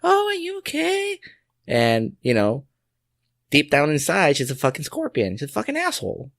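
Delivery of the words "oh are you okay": much higher, sweet fake-concerned old lady voice; mock old lady. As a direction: put on a syrupy little old lady. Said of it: "she looked old and she was like".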